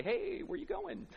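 high, slow mock wife voice; Sarah asking. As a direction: lightly play the curious wife. Sarah voiced in retold scene.

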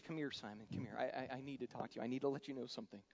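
soft, low coaxing; tender tuck-in. Retold or imitated. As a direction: draw him close like tucking in a child. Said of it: "it's a tuck-in moment".

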